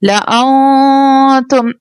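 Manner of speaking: ला आऊं तुम drawn out slowly, syllable by syllable; slow Quran recitation. Shown as a demonstration. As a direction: recite each syllable slowly with long vowels. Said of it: "क्या बन जाएगा".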